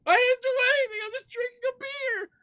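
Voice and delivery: very high squealed self-defense; hammed-up falsetto protest; mock panic. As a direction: plead innocence in a frightened squeak. Acts out his panicked self-defense.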